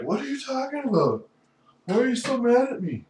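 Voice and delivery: very high put-on voice, questioning; exaggerated high-pitched mimicry of a father; imitating dad. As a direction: play an indignant dad in a high squeal. Plays his dad's imagined reply.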